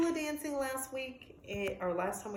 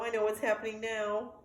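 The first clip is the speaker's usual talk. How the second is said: plain reading voice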